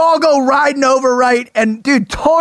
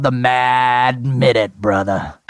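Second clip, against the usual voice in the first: announcer voice; 12 semitones lower, 3 dB quieter, slower than usual. Pretend. Announcing the game name.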